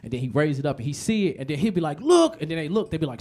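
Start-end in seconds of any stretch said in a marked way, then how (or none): 2.0-2.4 high loud frightened yelp; panicked passenger yelling; alarmed shout. Retold or imitated. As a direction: point and cry out in panic. Nervous plane passenger shouting.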